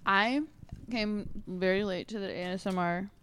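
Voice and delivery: ordinary talk, nothing marked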